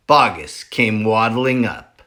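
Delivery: usual speaking voice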